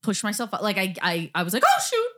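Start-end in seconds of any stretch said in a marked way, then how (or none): 1.7-2.1 mild mock surprise, pitched high; quick startled realization, much higher; surprised oh shoot. Realizing she hit something.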